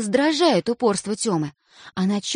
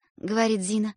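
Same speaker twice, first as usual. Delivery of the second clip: ordinary delivery, nothing marked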